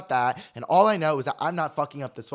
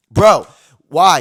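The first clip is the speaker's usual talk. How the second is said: loud, exasperated cry; outraged outburst; 4 semitones higher, 15 dB louder than usual. Real, not acted. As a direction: burst out at the unfair price. Outraged at tax amount.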